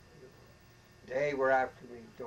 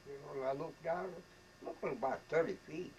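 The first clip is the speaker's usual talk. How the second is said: usual speaking voice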